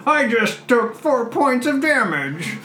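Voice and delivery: squeaky high dwarf complaint, slow; pained dwarf, high and slow. Acted. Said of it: "Committed to the bit".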